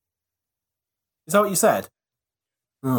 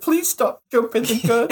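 slower, higher-pitched imitation of pleading; mock desperate plea; 6 semitones higher than usual. Teasing a friend who guesses early, mock desperate. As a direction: plead like an exasperated man, jokingly. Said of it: "Paul's desperate".

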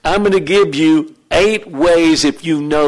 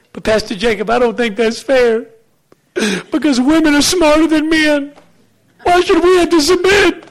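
high-pitched, increasingly indignant complaint; mock protesting woman; 9 semitones higher than usual. Pastor mimicking women objecting to submitting, high indignant voice. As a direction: climb into a squeaky put-on female protest.

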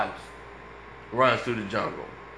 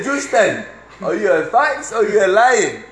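high, louder hammed-up Indian accent; mock Indian accent; 7 semitones higher, 7 dB louder than usual. Coworker from India quizzes Justin, mock Indian accent. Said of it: "Stop doing the accent".

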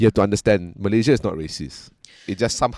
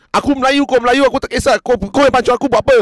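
much higher, louder, rushing quarrel; angry road-rage driver; 14 semitones higher, 6 dB louder, faster than usual. Retold or imitated. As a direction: fire it off like a furious driver arguing through the window. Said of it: "the other guy just goes".

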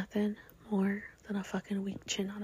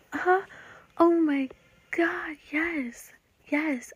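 excited; 8 semitones higher, 8 dB louder, much slower than usual. Retold or imitated. Thrilled reply in retold chat.

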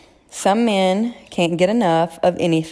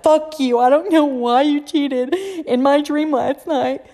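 mock angry shout, pitched up; mock furious husband; 8 semitones higher than usual. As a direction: play the husband yelling about a dream, half joking. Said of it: "Brandon's gonna come home and be like".

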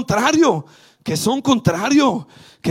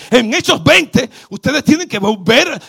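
normal talking voice

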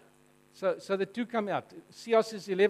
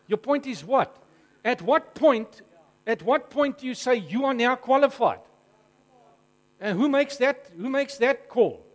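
louder, pressing challenge; a heated, louder demand for an answer; challenging; 3 semitones higher, 7 dB louder than usual. Acted. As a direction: fire the questions back hard. Preacher voicing a heated challenge.

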